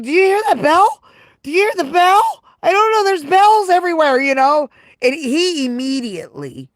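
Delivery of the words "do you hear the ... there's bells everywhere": high, loud, dazed and confused; dazed after the hit. Retold or imitated. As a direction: play it ringing-eared and lost, much higher and louder. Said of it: "I was like".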